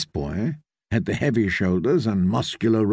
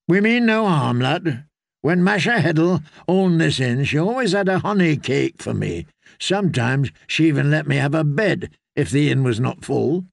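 reassuring character voice, pitched up a little; kindly septon; 3 semitones higher than usual. Acted. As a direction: put the lad at ease with a memory.

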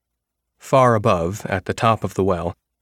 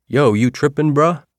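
loose, higher slangy delivery; street slang voice; 6 semitones higher, 5 dB louder than usual. Pretend. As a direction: toss it off like a teen. Narrator tries out slang phrase.

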